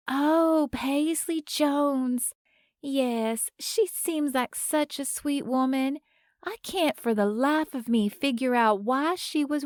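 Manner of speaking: put-on sweet older Southern woman; Southern lady. A narrator's reading. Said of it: "the older Southern woman says".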